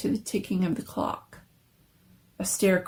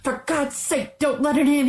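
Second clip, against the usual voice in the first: high, louder terrified plea; 7 semitones higher, 3 dB louder than usual. A narrator's reading. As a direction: beg her in panic. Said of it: "he cried, the old man trembling".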